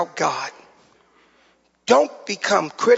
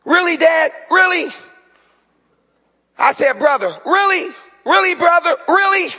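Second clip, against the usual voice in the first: incredulous "Really?", pitched higher each time; mock disbelief; 8 semitones higher than usual. Pastor mocking a forty-year-old man, incredulous. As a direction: imitate kids saying "really" in disbelief. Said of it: "like my kids tell me".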